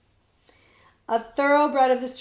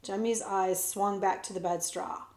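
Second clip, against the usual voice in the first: plain reading voice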